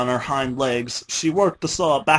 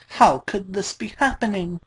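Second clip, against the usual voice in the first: tearful; 6 semitones higher than usual. A narrator's reading. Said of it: "Dash was tearing up".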